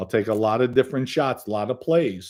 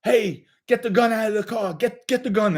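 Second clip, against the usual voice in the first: retold yell; 8 semitones higher than usual. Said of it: "When somebody yells".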